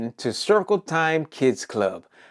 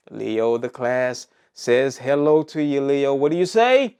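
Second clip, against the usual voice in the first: ordinary talk, nothing marked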